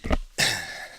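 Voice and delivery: short rough cough after choking on coffee. Real, not acted. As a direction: clear the last of the choke with a cough. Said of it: "I'm choking on this coffee".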